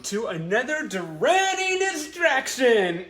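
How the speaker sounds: slow, high announcer welcome; show intro. Acted. Host opening the show for her fans, slow announcer welcome. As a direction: roll out the show's name like a host.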